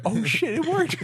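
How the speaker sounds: high, loud burst of astonishment; amazed reaction. Retold or imitated. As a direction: act out a startled discovery, voice shooting up. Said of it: "I was like".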